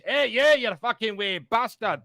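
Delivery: teasing friends with a jokey Scottish accent. Shown as a demonstration. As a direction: ham up a Scottish brogue. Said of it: "That's Scottish".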